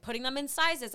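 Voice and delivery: ordinary talk, nothing marked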